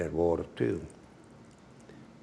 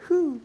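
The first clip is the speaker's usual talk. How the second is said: high whew; 5 dB louder than usual. Real, not acted. Reacts to heat or steam.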